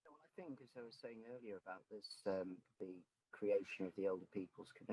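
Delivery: very faint, almost lost under the line; so low the words barely come through; barely audible. Real, not acted. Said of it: "[name], your voice is very low".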